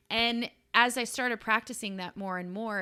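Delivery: ordinary talk, nothing marked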